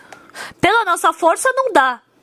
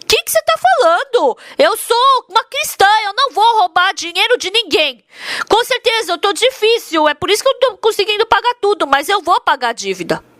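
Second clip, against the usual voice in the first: indignant; 6 semitones higher than usual. Retold or imitated. Wife refusing the loan shark's offer, high indignant protest. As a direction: push back, offended.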